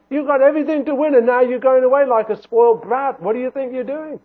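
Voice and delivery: loud, high mock telling-off; indignant scolding. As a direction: scold him like a spoiled brat. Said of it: "He didn't say that".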